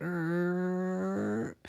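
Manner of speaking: high, soft strained groan; grinding groan. Retold or imitated. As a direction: grind the sound out slowly. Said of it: "grinds it out".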